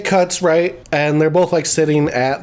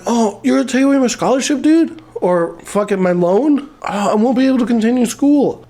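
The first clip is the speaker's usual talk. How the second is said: high, whiny put-on plea; hammed-up high-pitched complaint; feeble mock begging; 7 semitones higher than usual. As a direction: imitate a student protesting weakly. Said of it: "That's not how you fucking beg".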